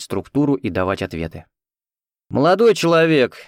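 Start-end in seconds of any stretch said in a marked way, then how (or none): 2.3-3.4 put-on irritated young woman, louder and higher; annoyed woman. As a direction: snap at the sleeping passenger. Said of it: "недовольный женский голос".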